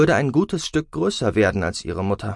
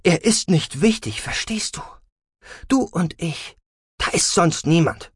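high, emphatic, exasperated mother; testy, final insistence; insistent irritation; 8 semitones higher than usual. A narrator's reading. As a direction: drive each phrase home in short bursts. Irritated mother shuts down the subject.

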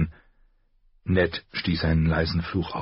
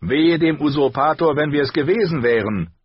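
higher, louder knightly threat; threatening knight; 9 semitones higher, 6 dB louder than usual. A narrator's reading. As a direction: warn the usurper's man with scorn. (drohender Ritter, höher und lauter)

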